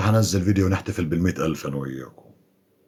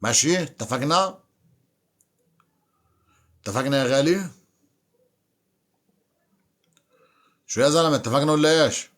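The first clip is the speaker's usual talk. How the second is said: raised, higher calls to a silent viewer; loud coaxing call, drawing the first word out slowly; 5 semitones higher, 4 dB louder than usual. Real, not acted. Calling a viewer on the live stream to answer, loud and coaxing.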